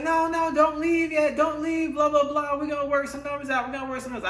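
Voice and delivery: high, pleading mock voice of the saleswoman. Mocking the saleswoman who won't let him leave, high pleading mimicry. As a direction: squeak out her fake pleading.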